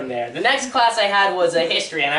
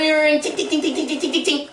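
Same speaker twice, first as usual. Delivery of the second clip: muffled bell; 7 semitones higher than usual. Retold or imitated. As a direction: ring choked into quick high tings. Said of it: "like something's muffling it".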